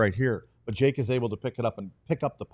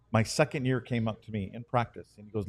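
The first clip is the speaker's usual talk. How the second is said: usual speaking voice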